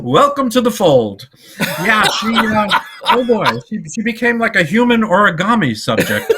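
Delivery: high, loud and amused; cracking up mid-story; joking through laughter. Real, not acted. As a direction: fight laughter while getting the joke out. Joking amid shared laughing fit.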